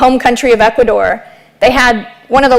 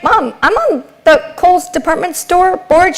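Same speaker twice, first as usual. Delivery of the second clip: higher, slow, spelled-out insistence; insistent daughter; 6 semitones higher than usual. Retold or imitated. Daughter spelling it out to mom, higher and slow. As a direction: say it slowly so mom gets it.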